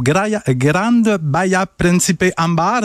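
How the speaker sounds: Spanish accent. Shown as a demonstration. Host tries a Spanish accent on the resort name. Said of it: "Je sais pas si mon accent est bon".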